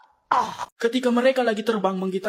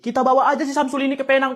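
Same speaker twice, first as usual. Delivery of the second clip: usual speaking voice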